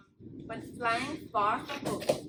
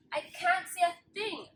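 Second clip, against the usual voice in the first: yelling; 5 semitones higher, 4 dB louder than usual. A narrator's reading. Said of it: "yelled Pip".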